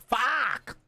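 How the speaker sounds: high imitated yell of frustration; mock outburst. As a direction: blurt it like he did, high. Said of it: "He's like".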